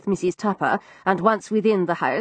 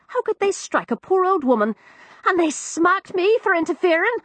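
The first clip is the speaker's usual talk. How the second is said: put-on higher, young cockney maid; frightened servant girl, higher cockney; Florrie's voice; 11 semitones higher than usual. Narrator voices East End maid.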